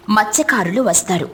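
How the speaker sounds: plain reading voice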